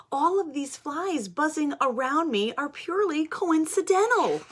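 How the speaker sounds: high, loud, innocent pigeon voice; defensive pigeon. Acted. Pigeon denies he smells.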